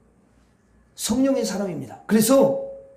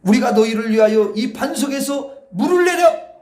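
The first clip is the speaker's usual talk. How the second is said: higher, louder, slow shouted rebuke; angry shouted rebuke; 5 semitones higher, 7 dB louder than usual. Acted. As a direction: bellow it at the rebels, slowly. Reading Moses' angry words.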